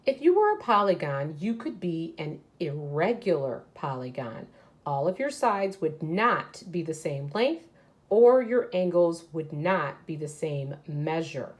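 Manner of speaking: put-on reading aloud to children. Teacher reading a picture book to her class, lively read-aloud.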